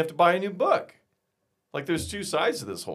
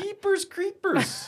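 goofy cartoon voice; much slower than usual. Goofy exclamation.

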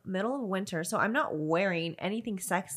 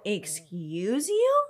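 drawn-out, affronted question; indignant retort; 3 dB louder, much slower than usual. Retold or imitated. Woman turns on the man who groped her, indignant. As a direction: stretch it out in offended disbelief. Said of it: "I turn around so pissed".

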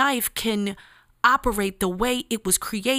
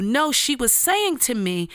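normal talking voice

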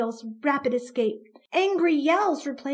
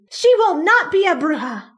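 loud, high furious denial; mother furiously defending her daughter; 6 semitones higher, 10 dB louder than usual. Acted. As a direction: hurl the denial at him. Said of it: "Mama straightened up furiously".

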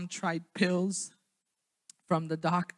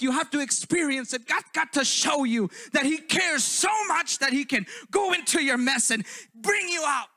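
fervent, high, loud pulpit preaching; preaching at full force; 11 semitones higher, 9 dB louder than usual. Acted. Preacher urging the congregation to know Jesus, fervent loud preaching. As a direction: preach it out, building to a shout.

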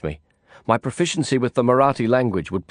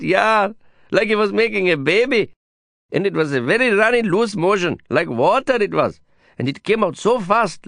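higher, lively gossip voice; chatty neighbor woman, pitched up; 10 semitones higher than usual. Acted. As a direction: relish the scandal like a street busybody.

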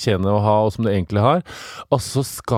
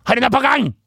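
imitating boss; 12 semitones higher, 3 dB louder, faster than usual.

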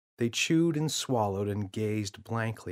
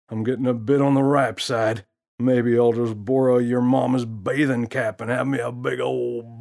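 a cocky, smirking gorilla, louder; smooth-talking prankster teasing the kid; gorilla's voice; 3 semitones higher, 7 dB louder than usual. Acted. Gorilla plans bubble bath.

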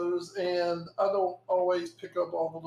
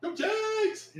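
mock fan cheer. Mocking Florida fans with a high, hammed-up cheer. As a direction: Go Jags cheered much higher.

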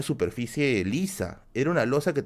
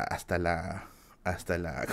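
normal talking voice

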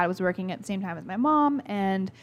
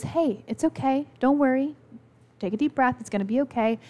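put-on gentle, higher grandmother voice calming a child; soothing nana; 5 semitones higher than usual.

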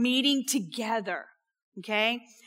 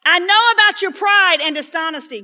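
loud, higher accusing snap; angry accusation; 6 semitones higher, 8 dB louder than usual. Acted. Eliab to David, a loud angry accusation. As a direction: hurl it at your little brother. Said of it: "Eliab being angry".

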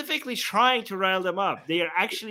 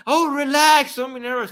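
mock reassurance; 6 semitones higher, 4 dB louder than usual.

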